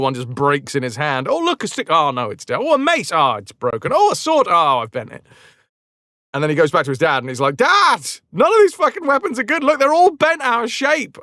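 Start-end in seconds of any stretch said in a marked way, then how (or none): 1.3-5.2 mock childlike glee, instantly deflated; easily disappointed boy. Boy finding and breaking weapons. 7.6-11.2 put-on high, fast kid protest; hammed-up child's shouted complaint; complaining boy. Boy complaining to his dad.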